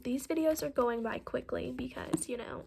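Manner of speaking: real whispered aside after the reading; softly whispered afterthought. Real, not acted. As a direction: whisper it off to the side. Reader's aside after chapter end.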